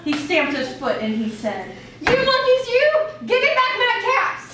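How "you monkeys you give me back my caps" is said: much higher and louder, stamping mad; quite angry peddler. Acted. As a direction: demand the caps back furiously. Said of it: "Now he felt quite angry".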